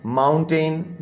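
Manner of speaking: Mountain in two beats, mount-ten; slight gap between mount and टेन; split into syllables. Shown as a demonstration. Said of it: "दो syllable हो गए".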